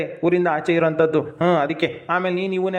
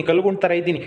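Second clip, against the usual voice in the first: ordinary delivery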